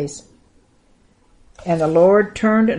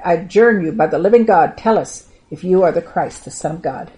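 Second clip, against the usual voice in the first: ordinary delivery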